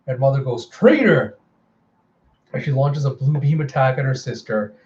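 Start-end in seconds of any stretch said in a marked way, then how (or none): 0.7-1.4 high, loud accusing yell; furious cry. Acted. Mother screams at the treacherous sister. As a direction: hurl the word at her.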